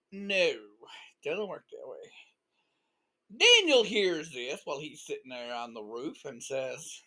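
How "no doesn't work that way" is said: ordinary delivery, nothing marked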